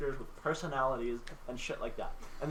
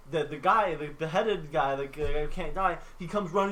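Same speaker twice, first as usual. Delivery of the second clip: ordinary talk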